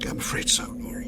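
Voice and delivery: soft regretful whisper; quiet sorrowful murmur. Acted. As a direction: confirm it gently. Confirming the death softly.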